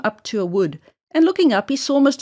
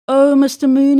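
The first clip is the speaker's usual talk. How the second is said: louder call up to the moon; crying out; 3 semitones higher, 7 dB louder than usual. A narrator's reading. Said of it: "he cried".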